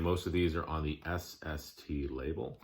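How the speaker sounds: ordinary delivery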